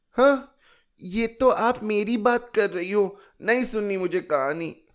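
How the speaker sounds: put-on high, loud little girl taking offence; a child flaring up in a huff, high; sulking child. A narrator's reading. Chilki sulks that the story is about her, high and loud. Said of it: "चिल्की बिचुरने लगी".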